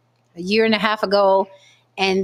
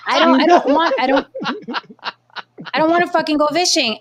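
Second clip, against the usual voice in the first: laughing refusal; 6 semitones higher, faster than usual. Real, not acted.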